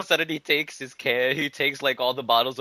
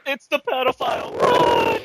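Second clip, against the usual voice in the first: high mock scream of alarm; panicked warning; 6 dB louder than usual. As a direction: shriek it out to scatter everyone, playfully.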